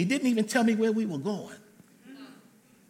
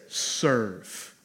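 low, drawn-out forgive; 8 semitones lower than usual. Acted. God's word, low.

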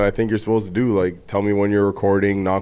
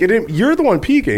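high-pitched indignant comeback; squabbling in a raised pitch; heated protest; 11 semitones higher than usual. Real, not acted. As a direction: throw the blame back, voice shooting up. Heated squabble over mic levels.

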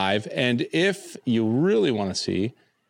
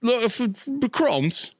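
high-pitched hammed-up Cockney; mock Cockney accent; 11 semitones higher than usual. Riffing on growing up in the streets, high mock Cockney. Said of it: "That's very Cockney".

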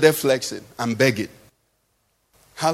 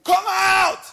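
sharp, much higher barked command; forceful shout of come out; 13 semitones higher than usual. Shown as a demonstration. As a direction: shout it like an exorcist casting out. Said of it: "Normally it would have been".

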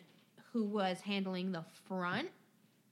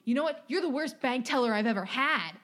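mock angry customer; 3 semitones higher, 7 dB louder than usual. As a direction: brush her off loudly like an entitled customer. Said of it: "he was like".

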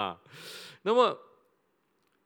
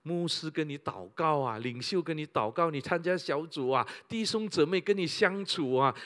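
ordinary talk

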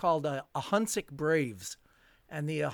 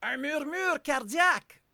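French doctor's verdict; 10 semitones higher than usual. Retold or imitated. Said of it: "And so he said".

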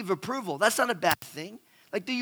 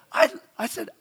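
ordinary delivery

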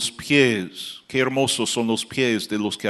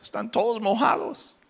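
puzzled son; 6 dB quieter than usual. Retold or imitated. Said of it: "Él dijo".

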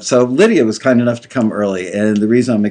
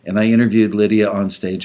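ordinary talk

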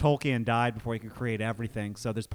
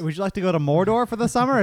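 travel agent; 7 semitones higher, 7 dB louder than usual. Pretend. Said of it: "when you work at the travel agency".

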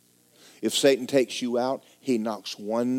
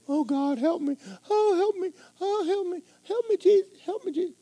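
high, pleading cry of help me; hammed-up high whimpering prayer; mock wailing prayer. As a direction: ham up a desperate whiny plea to God. Mock desperate prayer by believer.

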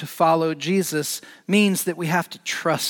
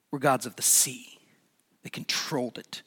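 whispered, much softer; 4 semitones lower, 7 dB quieter than usual. Real, not acted. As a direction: breathe it out as a hushed secret. Whispered for emphasis.

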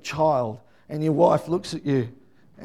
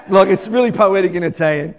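ordinary talk

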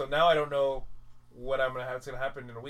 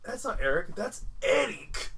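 put-on high, loud, slow insistent kid correction; mildly high, drawn-out childlike insistence; imitating kid; 8 semitones higher, 6 dB louder, slower than usual. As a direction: correct him like a stubborn child. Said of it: "when he's like".

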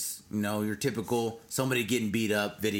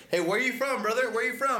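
higher, casual teasing question; friendly interrogation of the cameraman; 11 semitones higher than usual. Retold or imitated. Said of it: "[name]'s like".

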